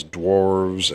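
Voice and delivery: usual speaking voice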